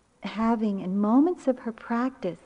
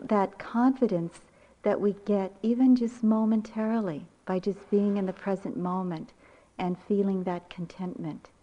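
normal talking voice

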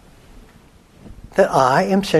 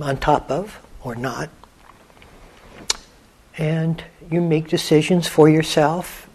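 softer meditation voice with long pauses; guided meditation; 5 dB quieter than usual. Acted. Teacher guiding listeners to let the quiet in, unhurried meditation voice. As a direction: guide listeners gently inward, leaving long silences.

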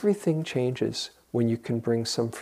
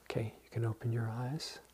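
gentle guide; 15 dB quieter, faster than usual. Shown as a demonstration. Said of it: "ending the guided exercise".

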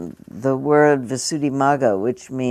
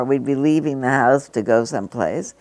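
ordinary talk

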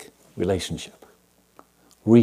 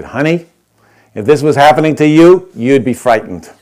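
louder, blunt no-nonsense comeback; feisty old woman cutting through the comfort; feisty retort; 4 semitones higher, 10 dB louder than usual. Retold or imitated. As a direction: hit her with it like a Zen master. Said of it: "this feisty gal".

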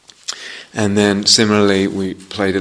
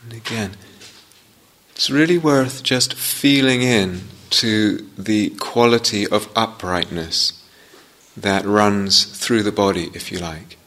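guided meditation. Real, not acted. Teacher guiding students into upright posture, unhurried meditation voice. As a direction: ease each phrase out, leaving space after it.

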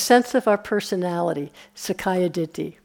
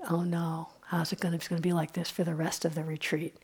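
mock worry; 3 semitones lower, 11 dB quieter than usual. Voicing a worried meditator.

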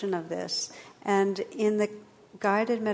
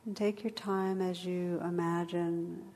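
guided meditation; 3 dB quieter than usual. Acted. Leads a meditation.

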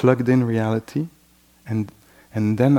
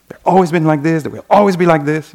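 louder, slowing hard on no nuance, rigid anger; angry certainty; 4 semitones higher, 9 dB louder, faster than usual. Retold or imitated. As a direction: voice the mind caught up in anger. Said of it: "thoughts that are produced by anger".

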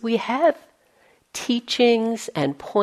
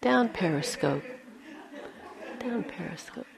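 gentle self-talk. Retold or imitated. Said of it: "it's like".

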